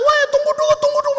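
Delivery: very high, loud plea to stop; Rumi yelling. Acted. Teacher being beaten begs the mob to wait, yelling. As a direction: yell high over a mob. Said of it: "Ruminya kan teriak-teriak".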